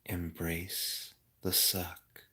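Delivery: soft, slow gloomy calm. Pretend. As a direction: read it soothingly, hushed. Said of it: "I'm gonna read the chat in a really calm voice".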